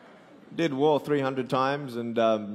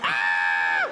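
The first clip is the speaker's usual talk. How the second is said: a loud, lower shriek of fright; girl's scream; 6 semitones lower, 4 dB louder than usual. Retold or imitated. Little girl screams at the roaring man. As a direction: scream like a startled kid. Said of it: "She's like".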